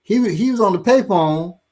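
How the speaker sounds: ordinary delivery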